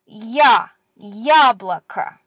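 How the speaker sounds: "ya" said with a Y glide in front, slowly, for "яблоко"; slow clear demo of "яблоко" with a soft ya; soft ya sound. Shown as a demonstration. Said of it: "the soft, quote-unquote, version".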